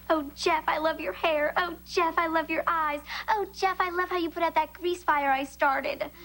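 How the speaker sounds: high-pitched gushing over a crush; mocking rival imitation.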